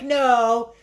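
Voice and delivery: high, drawn-out mock wail; mock dismayed cry. Retells character's cry as friend dies.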